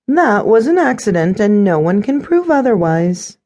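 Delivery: high, lilting, obviously lying voice; transparently false innocence, pitched high; sing-song lying. A narrator's reading. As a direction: deny it in a singsong. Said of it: "in a sing-song, I'm lying voice".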